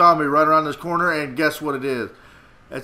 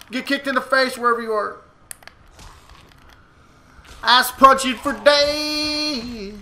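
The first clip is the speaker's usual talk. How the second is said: put-on voice; 8 semitones higher than usual. Put-on voice for game line.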